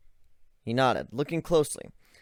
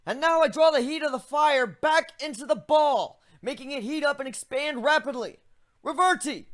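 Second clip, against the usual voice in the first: higher, eager explaining voice; a young griffin walking a friend through his magic; griffin hero voice; 4 dB louder than usual. Acted. Griffin finishes the spell.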